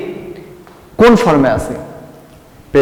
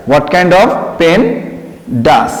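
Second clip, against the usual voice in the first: slow dictation; much slower than usual. Shown as a demonstration. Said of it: "teacher dictating".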